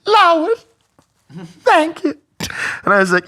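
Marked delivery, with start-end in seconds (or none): 0.1-2.1 high, loud, overwhelmed thanks; hammed-up grateful lady, high and loud; imitating the grateful lady. Waitress thanks the big tipper, mock tearful and high. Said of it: "She's like".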